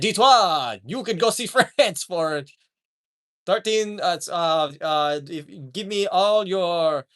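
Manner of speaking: halting mock French, breaking into laughs; bad French accent. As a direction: fumble through a fake French plea. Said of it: "I can't do a French accent".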